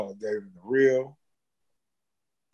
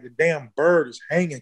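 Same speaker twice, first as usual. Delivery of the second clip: usual speaking voice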